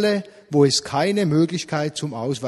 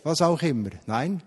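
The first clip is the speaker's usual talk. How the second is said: ordinary talk, nothing marked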